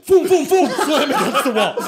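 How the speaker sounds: shouted crash noises, laughing; loud boom sound effects. Retold or imitated. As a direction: yell the impacts through laughter.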